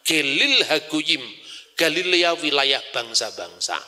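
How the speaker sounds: ordinary talk, nothing marked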